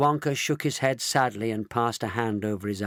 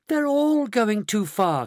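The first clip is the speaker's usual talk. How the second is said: high, drawn-out cry; 10 semitones higher, 4 dB louder, slower than usual. A narrator's reading. Charlie alarmed at the shrinking grandparents, crying out. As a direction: cry out higher and slower. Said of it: "cried Charlie".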